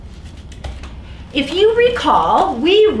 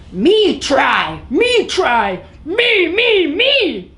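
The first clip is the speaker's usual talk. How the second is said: yelling; 5 semitones higher, slower than usual. A narrator's reading.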